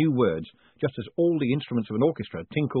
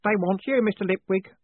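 put-on high, simple young voice; high-pitched, anxious youngster; nervous boy; 10 semitones higher than usual. A narrator's reading. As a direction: answer like a timid lad passing on a message. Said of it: "said Stanley".

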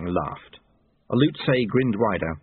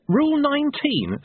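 very high, startled echo; pained, astonished yelp; incredulous outcry; 3 dB louder than usual. Acted. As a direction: cry it out in disbelief. Pained pupil reacts in disbelief.

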